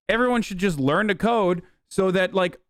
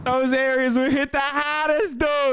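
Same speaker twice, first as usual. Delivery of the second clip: higher, hammed-up Boston accent on "hardest"; Massachusetts accent, pitched high and mocking; 8 semitones higher than usual. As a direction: parrot the Labor Secretary in a thick Massachusetts accent. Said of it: "there's certain accents where I'm like".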